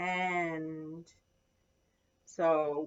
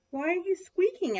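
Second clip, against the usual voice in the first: high, louder mouse voice; questioning mouse; 9 semitones higher, 5 dB louder than usual. A narrator's reading. Mouse asks Little Lion, high questioning voice. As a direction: ask it high, like a small mouse. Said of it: "asked Mouse".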